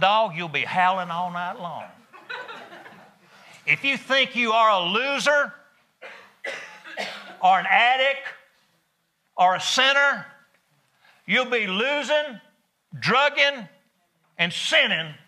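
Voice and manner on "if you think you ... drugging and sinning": higher, louder fervent list; preaching climax. Real, not acted. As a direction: hammer each word of the sinner's list.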